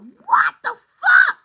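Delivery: high, loud, slow disbelieving yell; screaming. Real, not acted. Reader still reeling on stream, screaming. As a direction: stretch it out in shocked disbelief. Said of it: "Sorry, I'm screaming".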